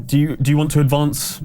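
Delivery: usual speaking voice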